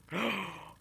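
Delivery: sharp acted intake of breath; shocked gasp. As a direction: gasp in sudden surprise. Acts out shocked reaction.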